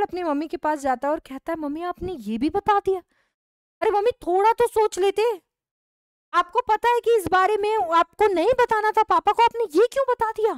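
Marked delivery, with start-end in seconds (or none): 1.6-10.5 higher and louder, complaining to mum; exasperated son. Acted. Son to mother for telling father, high exasperated complaint.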